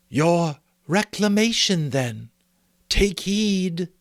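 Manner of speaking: high, slow spirit warning; a gentle but firm command; solemn ghost. A narrator's reading. Ghost warns Scrooge.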